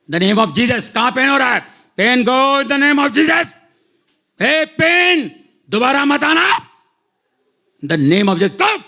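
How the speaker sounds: commanding prayer. Retold or imitated. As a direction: drive the pain out in prayer. Praying over phone against pain. (Phone पर दर्द को डाँटती प्रार्थना)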